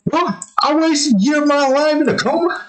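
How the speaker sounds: high squeaky disbelief at losing a year. Retold or imitated.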